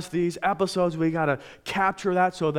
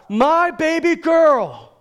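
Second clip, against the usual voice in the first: anguished high, loud, slow cry; 10 semitones higher, 8 dB louder, slower than usual. Retold or imitated. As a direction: cry it out like a desperate father. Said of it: "And he cries out".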